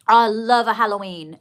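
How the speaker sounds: loud, gushing declaration; a big loud gush; excited. Shown as a demonstration. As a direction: belt out your love for Halloween. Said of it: "repeated, first take louder".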